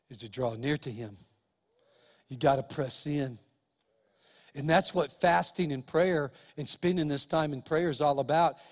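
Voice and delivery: ordinary talk, nothing marked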